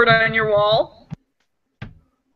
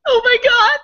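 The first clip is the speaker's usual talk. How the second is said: laughing; 10 semitones higher than usual. Real, not acted. Listener cracking up.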